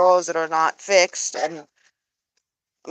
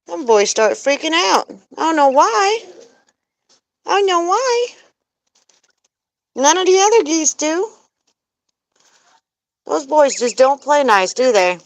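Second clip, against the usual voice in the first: pitched-up soothing chatter to the geese; high sing-song to the flock; high pet talk; 7 semitones higher than usual. Real, not acted. Talking to geese after scare.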